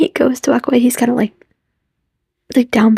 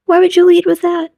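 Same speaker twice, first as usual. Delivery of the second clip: exasperated high question; 8 semitones higher than usual. Retold or imitated. Vlogger scolding the character, high exasperated question. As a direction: ask it high in disbelief.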